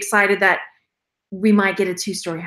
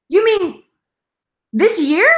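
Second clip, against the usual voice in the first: surprised mom; 8 semitones higher, 4 dB louder than usual. Retold or imitated. Mom's surprised question.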